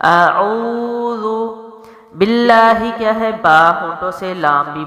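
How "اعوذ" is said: اعوذ with long rounded و, slow; tajweed recitation. Shown as a demonstration. Teacher repeating the word for students, slow recitation. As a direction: stretch the و in اعوذ, lips rounded. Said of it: "واو مد ہے تو لمبا بھی ہوگا".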